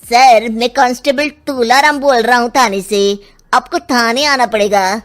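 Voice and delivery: constable down a phone line. Acted. Constable calling the father to the police station, phone voice. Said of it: "थाने से सुल्तान सिंह को phone आता है".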